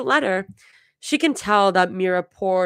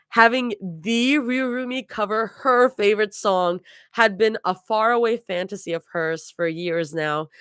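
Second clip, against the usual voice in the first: ordinary delivery, nothing marked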